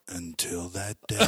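slow, grave mock voiceover; solemn documentary narration. Parodying a sports tragedy segment for a friend, mock-solemn narration. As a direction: draw it out like a tragic TV feature. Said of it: "you know how they always start in slow-mo".

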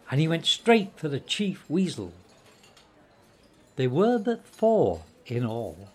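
ordinary delivery

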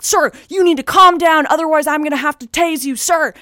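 imitated cop warning. Cop threatening to tase an unruly man, mock commanding. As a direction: play a cop ordering someone to calm down.

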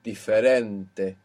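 "diferente" said slowly, stressed on REN; slow demo of "diferente", leaning on the second last syllable; penultimate stress. Shown as a demonstration. Teacher showing the natural accent rule, "diferente" stressed on REN. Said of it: "accent will be on the penultimate syllable".

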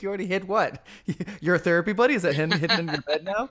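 high, loud laughing disbelief; laughing mock question. Mock-quoting misheard line.

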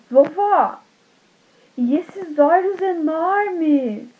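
high, very slow wonder; amazed little girl. Acted. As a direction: draw out enormes in awe. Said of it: "a Chapeuzinho falou assim".

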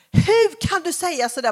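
woman at the fair yelling, mock angry; retold shout. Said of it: "en kvinna som skrek åt oss".

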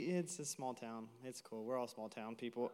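sheepish hometown aside; quiet aside. Real, not acted. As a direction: drop it to a sheepish mutter. Self-deprecating hometown aside.

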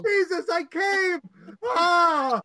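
high, slowed, hammed-up yelling; mock panicked shout. After the scrambled-porn reveal, a hammed-up panicked shout. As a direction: wail it like a confused crisis.

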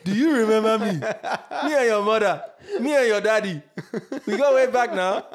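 high, loud Nigerian auntie voice; playful Nigerian relative accent. Retold or imitated. Voicing Nigerian relatives asking favours.

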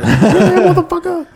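mock angry neighbour. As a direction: play the neighbour cursing at us through the door. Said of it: "He's probably flicking all of us off".

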